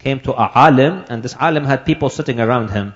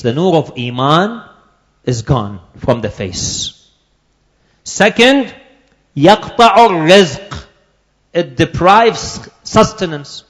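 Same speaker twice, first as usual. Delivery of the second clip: preaching; 5 semitones higher, 4 dB louder than usual. Acted. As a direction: drive each consequence home to the congregation.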